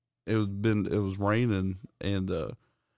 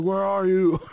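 put-on higher, louder drunk call; 11 semitones higher, 7 dB louder than usual. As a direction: call out searching. Drunk friend calling out.

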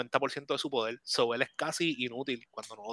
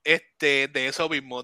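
ordinary delivery

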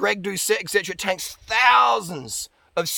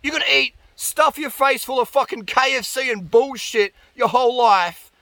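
exasperated rant; 5 semitones higher, 4 dB louder than usual. Retold or imitated. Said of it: "And I'm like".

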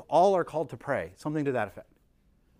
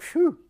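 relieved phew; 13 semitones higher than usual. Pretend. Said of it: "some of us maybe right now are like".

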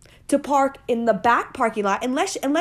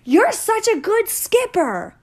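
put-on much higher, slow child praise; higher sweet kid voice; child's voice; 9 semitones higher, slower than usual. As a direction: imitate your little girl cheering. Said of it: "my kid the other day was like".

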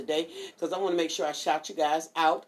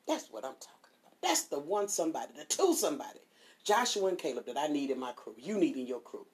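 normal talking voice

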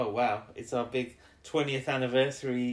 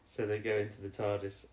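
ordinary delivery

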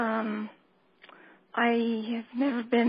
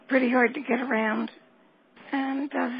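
ordinary talk, nothing marked

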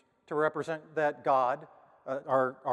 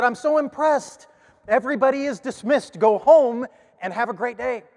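high and loud, the first words slowed; thrilled teacher; 9 semitones higher, 11 dB louder than usual. Retold or imitated. Teacher dismissing the class, high and loud with delight.

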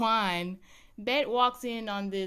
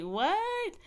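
much higher, a squeaky stunned what; shocked what; 12 semitones higher than usual. Retold or imitated. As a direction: yelp it out in disbelief. Thinking it was Jody's video.

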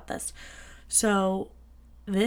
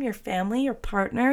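usual speaking voice

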